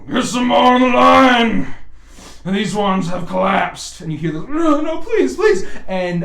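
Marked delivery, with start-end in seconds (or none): 0.1-4.0 loud, high barked order across the forge; harsh overseer yelling at workers; overseer's shout. Acted. Overseer shouting at chain gang. 4.5-5.6 high, loud pleading with a laugh in it; hammed-up desperate plea; begging prisoner. Prisoner begging when pulled out.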